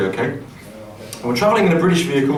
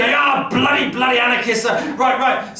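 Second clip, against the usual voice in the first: furious German shout; 7 semitones higher than usual. Retold or imitated. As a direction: yell it like an outraged cop. Policeman shouts in mixed English.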